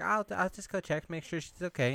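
high-pitched imitation of a girl; put-on girl voice. Girl offers to check on friend, high mock-girl voice. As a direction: lift the pitch, offer to help.

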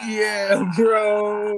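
loud, high, drawn-out agreement; laughing, exasperated yell; exasperated outcry. Real, not acted. Agreeing in shared exasperation over song.

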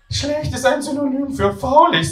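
very high-pitched flatmate; Hermine. Acted. Flatmate defining a word for the others, very high voice. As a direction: put on a voice far above your own.

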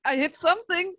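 high, laughing protest. Real, not acted. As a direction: insist on it through a laugh. Defending bad roll, laughing.

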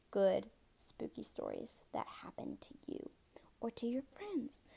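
hushed, breathless whisper; spooky whisper. Pretend. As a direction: drop to a secretive whisper for the spooky part. Spooky stories teased in hushed voice.

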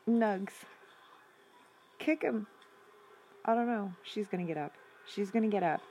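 normal talking voice

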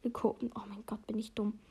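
whispered self-scolding under the breath; embarrassed aside. Real, not acted. Reader mocks herself for a misreading, whispered. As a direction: hush it to yourself after a slip.

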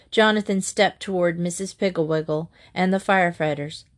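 ordinary delivery

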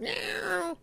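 a falling cat meow. Retold or imitated. Repeating what the dead cat would say: high falling meow. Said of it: "The cat would have said".

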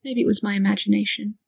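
ordinary delivery, nothing marked

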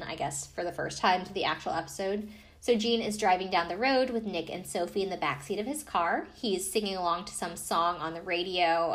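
usual speaking voice

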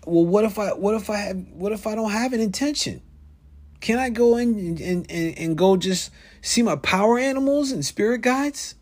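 eager questioning. Retold or imitated. Retelling his eager question to guide.

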